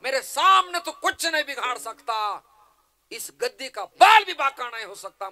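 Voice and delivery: furious full-force roar; Bhishma. Acted. Bhishma rebukes Shakuni for threatening the throne, furious booming shout. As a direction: thunder out each phrase, very high and loud. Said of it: "पितामह भीष्म आता ओरडले".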